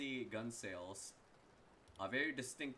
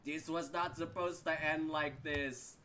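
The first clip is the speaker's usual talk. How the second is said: dying gargoyle; 6 semitones higher, 5 dB louder, slower than usual. Acted. Falling gargoyle cries out.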